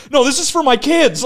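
embarrassed squeal of an excuse; flustered denial. Retold or imitated. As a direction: blurt it like you've been caught. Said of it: "I was like".

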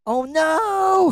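high, loud panicked cry of dismay; shocked yell. Retold or imitated. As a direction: yelp it like you've just been caught. Guy caught with stolen pen.

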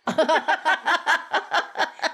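high burst of laughter. Real, not acted. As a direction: laugh at your own joke. Punchline about dead plant.

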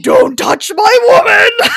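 hammed-up possessive yell; mock shout. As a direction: bellow it like a jealous hulk. Adam hulks out, shouts.

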